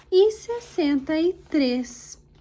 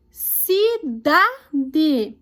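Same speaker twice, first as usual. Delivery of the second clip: Cidade pulled apart, stress on DA; teacher sounding out "Cidade", middle syllable strongest; stressed syllable demo. Shown as a demonstration. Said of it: "pronuncia com mais intensidade".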